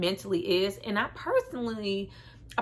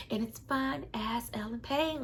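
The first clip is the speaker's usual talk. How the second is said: hushed swooning whisper; breathy whisper; 3 semitones higher, 6 dB quieter than usual. Real, not acted. Admiring the actor, hushed whisper. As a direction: whisper it like a smitten fan.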